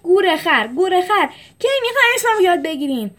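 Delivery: fed-up zebra, last part fast; exasperated correction. Acted. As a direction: Julius correcting the lion on his name. Correcting his own name.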